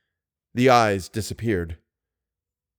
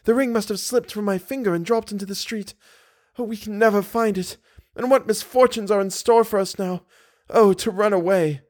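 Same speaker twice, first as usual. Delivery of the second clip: put-on high, agitated young woman's voice; distraught heroine; 10 semitones higher than usual. A narrator's reading. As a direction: lament in a high voice, refusing comfort.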